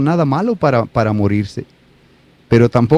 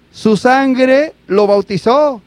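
higher, slow and weighty; slow, solemn statement; 7 semitones higher, much slower than usual. Acted. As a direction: let each word land slowly. The solemn point of the story. (declaración solemne y lenta)

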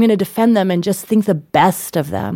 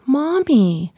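high-pitched imitation of a little kid; a small child earnestly correcting mommy; five-year-old child; 7 semitones higher than usual. Five-year-old son reminding mother to be thankful, childlike. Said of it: "who's five".

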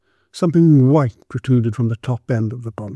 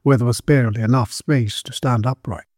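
plain reading voice

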